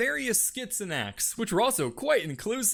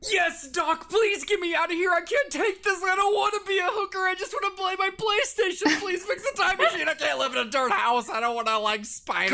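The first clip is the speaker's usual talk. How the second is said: high, pleading whine to the scientist; high whiny begging to Doc; desperate plea; 9 semitones higher than usual. Acted. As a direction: beg Doc to get you home.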